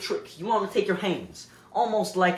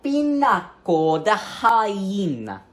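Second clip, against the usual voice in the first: slow, hammed-up Italian accent; 4 dB louder, slower than usual. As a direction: drawl it like a fake Italian chef. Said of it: "as we Italians call it".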